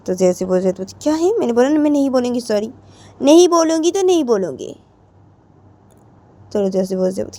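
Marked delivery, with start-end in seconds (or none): 0.8-4.8 high, loud, defiant refusal; चिढ़कर इनकार. Acted. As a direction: refuse stubbornly, voice raised. Acted quarrel, refusing to apologise. (चिढ़ी हुई, ऊँची आवाज़ में ज़िद)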